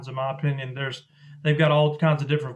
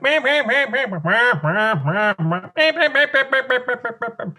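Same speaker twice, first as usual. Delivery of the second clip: high squeaky meow-like duck chatter; duck chatter imitation; 8 semitones higher, slower than usual. Caller showing how ducks on the water sound, in high duck chatter. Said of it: "ducks in the water, they're like".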